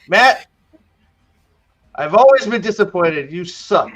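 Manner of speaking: high, loud cry, then pronounce judgment; hammed-up disappointed father calling out; mock anguished outburst. Ishmael berates his son after the stabbing, mock anguished outburst.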